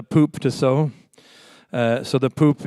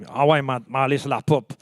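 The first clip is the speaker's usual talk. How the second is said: casual Montreal French, words run together; down-to-earth Montreal street French; Montreal French accent; 3 semitones lower, 5 dB quieter, faster than usual. Shown as a demonstration. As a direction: say it in everyday Québécois, unfancy. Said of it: "that's not how they talk. They say".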